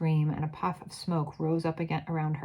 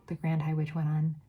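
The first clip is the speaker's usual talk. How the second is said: ordinary delivery, nothing marked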